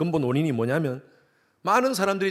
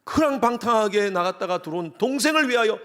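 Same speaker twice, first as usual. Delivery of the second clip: indignant complaint, higher and louder; older son protesting to father, higher and indignant; angry protest; 7 semitones higher, 4 dB louder than usual. Acted.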